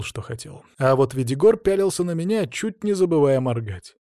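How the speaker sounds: ordinary delivery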